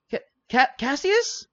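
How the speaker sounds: very high, loud stammered question; stammering astonishment. Acted. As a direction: stumble over the name in high-pitched disbelief. Darius recognizes Cassius, stammering.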